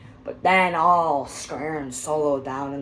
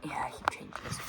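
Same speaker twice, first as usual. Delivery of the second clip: whisper; 8 dB quieter, faster than usual. Real, not acted. Whispered aside to self.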